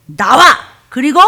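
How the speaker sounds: loud command to the demon. Shown as a demonstration. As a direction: order it out firmly. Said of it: "여러분이 귀신 쫓을 때 시작".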